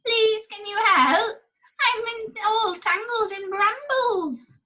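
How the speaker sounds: a stuck sheep crying for help; tangled sheep. Acted. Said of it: "A sheep was baaing loudly".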